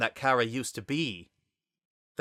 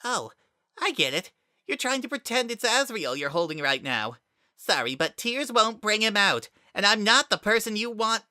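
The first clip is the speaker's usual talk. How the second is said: high, louder smug sneer; smirking; 11 semitones higher, 3 dB louder than usual. Acted. Kara smirking at Frisk who hugs him, high and loud. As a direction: twist the knife smugly. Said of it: "then smirked".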